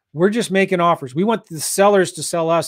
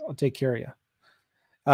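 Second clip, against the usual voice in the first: slow, soft and reassuring; slow soft reassurance to a customer; 5 semitones lower, 8 dB quieter, much slower than usual. Real, not acted. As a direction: promise him you've got him, gently. Reassuring a viewer named Ron.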